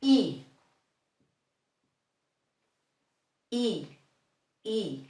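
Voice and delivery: short pure I, as ee; Spanish I. Shown as a demonstration. Teacher modelling the Spanish vowel I. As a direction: say I tight and clean. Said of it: "The following one".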